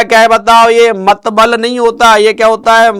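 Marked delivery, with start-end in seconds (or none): none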